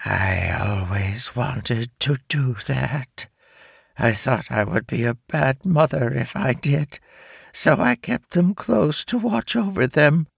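hissing voice that wavers, rising; unsure spider mother; wavering hissing voice. Acted. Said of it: "The hissing voice wavered".

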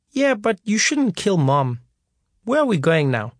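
a hint of a small boy questioning his father; young child. A narrator's reading. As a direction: ask it innocently. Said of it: "four-year-old asks father".

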